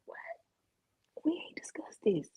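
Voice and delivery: whispered, stunned aside; whispered panic to herself; shocked whisper. Retold or imitated. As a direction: breathe it out in disbelief. Shocked aside at his claim.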